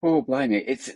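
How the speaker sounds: ordinary delivery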